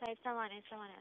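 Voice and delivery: thin phone line voice; over the phone. Real, not acted. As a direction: heard through a phone speaker. Doctor's voice heard via phone.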